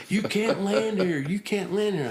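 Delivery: high, urgent repeated protest; frantic major. Retold or imitated. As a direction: wave him off in a panic. Major running out yelling.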